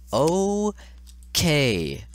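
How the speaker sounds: slow, high, drawn-out reassurance; forced calm. Real, not acted. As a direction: stretch it out as if convincing yourself. Forced calm after raging.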